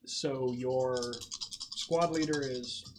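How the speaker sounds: ordinary delivery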